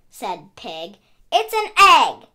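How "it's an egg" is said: insisting. Acted. Pig insists to Duck.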